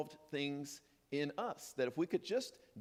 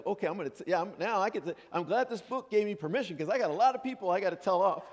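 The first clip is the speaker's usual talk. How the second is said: loud, higher, fast mock glee; mock gleeful permission; 4 semitones higher, 7 dB louder, faster than usual. Thrilled the book allows telling people off, in loud mock glee. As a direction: gloat over finally getting permission. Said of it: "we think like".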